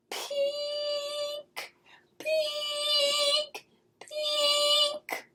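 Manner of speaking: high sung alarm beeps; high alarm chime. A narrator's reading. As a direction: ring it out like an alarm. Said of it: "went my alarm".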